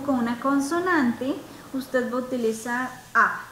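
usual speaking voice

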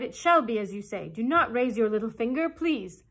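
loud, urgent begging, rising at the end; frightened pleading. A narrator's reading. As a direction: beg loudly for mercy. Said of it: "he cried".

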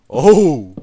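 sudden loud, high cry; startled yell. Real, not acted. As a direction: burst out in surprise. Startled by creeper at door.

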